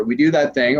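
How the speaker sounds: ordinary talk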